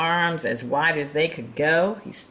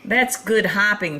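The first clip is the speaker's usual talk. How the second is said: higher and louder, full of wonder; impressed little hare; 6 semitones higher, 5 dB louder than usual. Acted. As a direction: marvel at the big hare's jump. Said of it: "thought the Little Nut Brown Hare".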